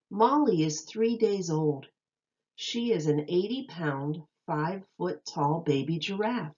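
bright, lilting read-aloud voice; storybook reading voice; reading to children. Acted. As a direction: read it like a picture book to little kids. Reads picture book to children.

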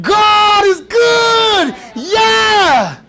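slow, high, ringing praise; joyful shout. Acted. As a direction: celebrate like you just crossed. Acted celebration after Red Sea.

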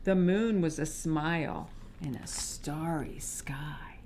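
soft sleepy whisper. A narrator's reading. Reader whispers the bedtime ending to children. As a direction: hush it like lights out.

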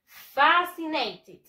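FAscinated, slow, high, punched FA; slow emphatic "FA-si-nay-tid"; stress on first syllable. Shown as a demonstration. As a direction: hit FA in "fascinated", stretch the rest. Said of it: "The stress syllable".

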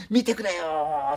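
slow, drawn-out desperate plea; frightened old woman pleading; pleading in fear. Retold or imitated. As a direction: beg them to look, terrified. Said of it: "ってすごいんだそうですよ".

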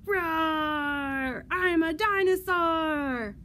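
loud, long-drawn dinosaur roar. Acted. Librarian reading the dinosaur's part to children, roaring loudly. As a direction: roar it out big, stretching the words. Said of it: "Look at that dinosaur reading his book".

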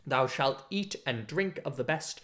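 ordinary delivery

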